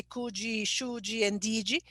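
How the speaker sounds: slow "could", "should", "did" ending in "jee"; Portuguese-style endings. Shown as a demonstration. What the student avoids saying, added "jee" endings. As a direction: turn each final d into "jee". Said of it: "in order not to say".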